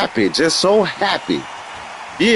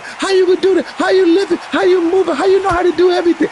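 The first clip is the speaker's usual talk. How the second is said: much higher, fast, mock-amazed coworkers; faster than usual. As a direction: squeal it like stunned coworkers asking how. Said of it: "They be like".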